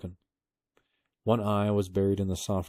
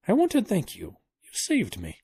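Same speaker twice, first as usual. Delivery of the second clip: put-on raised pitch, grateful old man; thankful elderly scholar, pitched up; high old professor voice; 14 semitones higher, 4 dB louder than usual. A narrator's reading.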